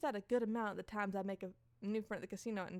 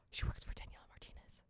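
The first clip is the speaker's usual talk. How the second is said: hushed whisper; whispered aside; 7 dB quieter than usual. Real, not acted. Streamer explaining who Martinez is, whispered aside.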